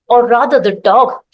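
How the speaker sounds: ordinary delivery, nothing marked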